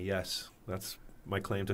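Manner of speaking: ordinary talk, nothing marked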